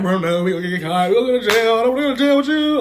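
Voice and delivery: scared of getting caught; panicked plea. Retold or imitated.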